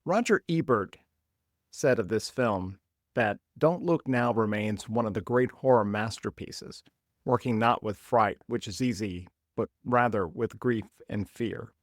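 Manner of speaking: normal talking voice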